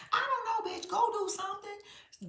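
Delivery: high, loud exasperated outburst; exasperated yell. Real, not acted. Ranting at a nosy castmate, loud and high. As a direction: throw it at her, fed up.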